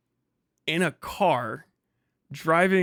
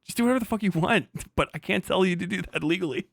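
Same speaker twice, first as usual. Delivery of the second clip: quick, higher mock lawyer; 5 semitones higher, faster than usual. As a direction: rattle it off like a lawyer. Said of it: "They're gonna go".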